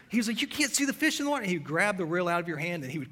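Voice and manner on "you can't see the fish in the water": a skipper hollering in disbelief, pitched way up; hollering captain. Retold or imitated. Captain hollering at clueless tourists, high mock holler. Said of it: "He would scream and he would holler".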